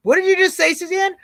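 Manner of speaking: high-pitched imitation of a startled viewer; mock astonishment. Playing a viewer questioning the host, mock astonishment. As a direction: ask it high, as if you can't believe it.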